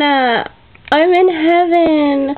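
very slow, savoring each word; drawn-out bliss. Real, not acted. Vlogger savoring her new frame die says it slowly in bliss. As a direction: stretch it out dreamily.

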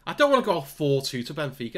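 ordinary delivery

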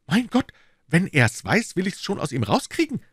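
an inspector itching to get a confession; eager inspector calling out. Acted. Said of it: "rief Lestrade".

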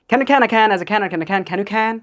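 tongue twister run together without pauses; sped up. Shown as a demonstration. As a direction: combine it all in one quick go. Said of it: "now speed up and combine together". (একটানা দ্রুত tongue twister)

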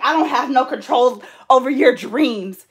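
imitated exasperated fiance. Fiance defending himself over her dream, exasperated. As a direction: drag out each word in mock exasperation. Fiance's protest in retold quarrel.

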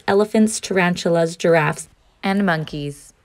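usual speaking voice

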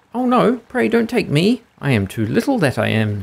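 very high little goat plea; small-voiced pleading goat. A narrator's reading. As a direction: plead in a tiny goat's high voice. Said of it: "with such a small voice".

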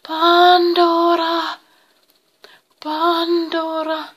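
high, loud, long-drawn eerie call; distant voices calling. A narrator's reading. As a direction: wail the name as if from far away. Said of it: "Kaukaiset äänet huusivat". (kaukaiset, venytetyt huudot)

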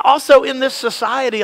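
normal talking voice

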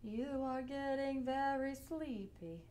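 slow, drawn-out hypnotist drone. Pretend. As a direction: lull them like a stage hypnotist. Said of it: "Sound like a robot".

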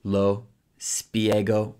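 spiego drawn out slowly; slow teacher demo of lo spiego; slow model phrase. Shown as a demonstration. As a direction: stretch out lo spiego for the learner. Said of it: "So te".

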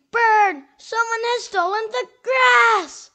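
loud, high panicked shout; alarmist doom warning. Acted. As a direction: yell the alarm at full force. Squirrel shouts a new alarm.

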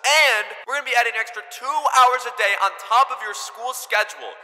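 high-pitched official announcer voice; intercom announcement. Pretend. Principal punishing students over the intercom, officious announcement. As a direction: address the whole school over the intercom. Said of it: "make a little intercom".

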